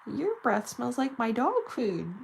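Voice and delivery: high, loud excited puppy voice; Ellie's dog voice. Pretend. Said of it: "She'd be like".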